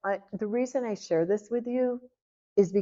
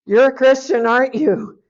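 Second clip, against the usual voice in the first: loud, raised-pitch accusing exclamation; excited blurting; 5 semitones higher, 10 dB louder than usual. Retold or imitated. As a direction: burst out loudly, as if you've found them out. Blurts out guess to the stranger.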